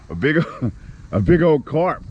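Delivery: high-pitched, laughing exclamation; laughing disbelief. Real, not acted. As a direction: break into laughs between the words. Losing a huge carp, laughing.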